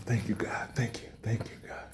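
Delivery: whispered prayer of gratitude; hushed grateful whisper; whispered thanks. Pretend. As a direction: breathe your thanks to God in secret. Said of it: "In the mind, you're like".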